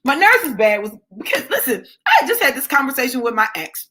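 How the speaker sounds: ordinary talk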